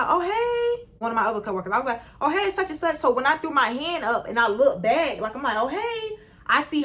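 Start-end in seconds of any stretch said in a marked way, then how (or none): 0.0-0.9 bright sky-high oh hey; high cheery greeting. Retold or imitated. Greeting coworker. 2.2-3.0 bright high hello; high cheery greeting. Retold or imitated. Greeting coworker. 5.6-6.2 bright sky-high oh hey; high cheery greeting. Retold or imitated. Greeting coworker.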